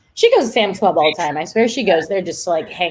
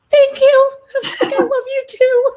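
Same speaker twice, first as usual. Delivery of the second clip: much higher, squeaky and overwhelmed, half laughing; gushing touched aunt; 3 dB louder than usual. Retold or imitated. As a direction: retell melting at her nephew's love, voice way up. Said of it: "And I was like".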